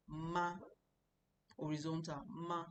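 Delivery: "ma" held level, no rise or fall; flat first tone. Shown as a demonstration. Teacher shows the first Chinese tone on "ma". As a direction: keep "ma" on one flat pitch. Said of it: "my voice is just flat".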